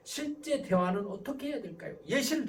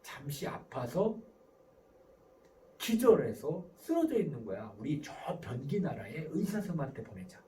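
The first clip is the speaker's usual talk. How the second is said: parent sweetly fibbing; 8 semitones lower than usual. Acted. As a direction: reassure a child with an absurd story. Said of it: "이런 부모들은 어떻게 합니까?". (아이를 달래듯 낮고 천천히)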